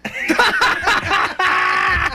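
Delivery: high shrieking laugh; a sudden howl of laughter. Real, not acted. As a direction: burst out laughing hard. [name] bursts out laughing.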